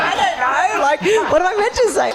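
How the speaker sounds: high, giggling and dragged out; flustered laugh. Retold or imitated. Caught out by producers, giggling and flustered. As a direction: laugh through a guilty excuse.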